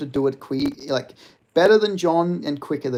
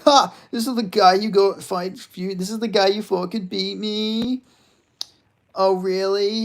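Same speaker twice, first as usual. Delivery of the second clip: higher, slowed smug taunt; mocking Jon Jones voice; 7 semitones higher, 4 dB louder than usual. Streamer mimicking a smug Jon Jones gloating at fans, mock voice. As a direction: gloat like a smug champ rubbing it in. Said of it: "that little fucking fake voice he does".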